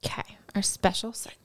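soft unvoiced echo of 'our special segment'; whispering. Real, not acted. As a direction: whisper 'special segment' back.

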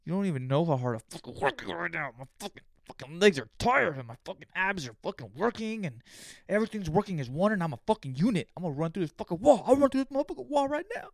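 psyche yourself up mid-set; hyped lifter's inner monologue, building to a roar; pumped-up self-talk. Retold or imitated.

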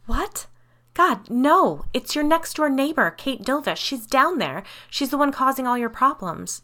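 high, loud, urgent outburst; frantic. A narrator's reading. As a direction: blurt the news out in exasperated alarm. Frantic correction after the escape.

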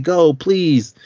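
high, loud mock begging; retold cry, pitched well up; retold yelling plea. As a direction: imitate a distressed woman yelling. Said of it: "She's yelling and screaming and crying".

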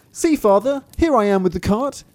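high, loud little-creature voice; crying out. A narrator's reading. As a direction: call proudly to father, high and loud. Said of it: "he cried out".

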